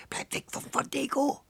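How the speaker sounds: very high, eerie wailing screech; howling crone. A narrator's reading. Old woman babbles her warning to Antonio, howling. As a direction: wail it out like a mad old witch. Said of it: "mit heulender Stimme und widrigem Kichern fortplapperte".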